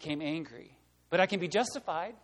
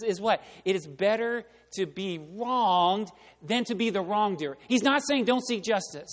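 loud, impassioned pulpit preaching that climbs higher; preaching at full force; 3 semitones higher, 6 dB louder than usual. Acted. Preacher tells the congregation about Jesus at the cross, impassioned and loud. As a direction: drive it home like a revival preacher. Preacher presses the point hard.

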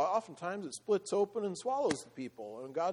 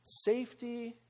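ordinary talk, nothing marked

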